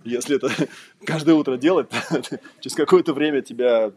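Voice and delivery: ordinary talk, nothing marked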